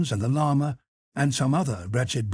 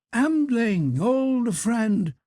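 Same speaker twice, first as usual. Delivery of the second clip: high, drawn-out hail; calling out; 8 semitones higher, much slower than usual. A narrator's reading. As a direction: hail a friend in a high, lilting cry. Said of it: "he cried".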